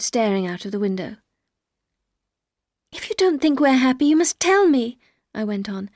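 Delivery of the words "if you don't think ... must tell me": higher and louder, insistent; urgent plea. A narrator's reading. Wife begs husband not to lie, urgent and loud. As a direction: push him for the truth.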